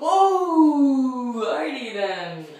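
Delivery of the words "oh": sharp cry from the cold; pained cry. Real, not acted. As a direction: yelp as the cold hits. First contact with ice water.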